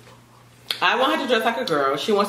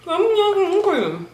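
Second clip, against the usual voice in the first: excited squeal; 12 semitones higher than usual. Real, not acted. Playful squeal at daughter.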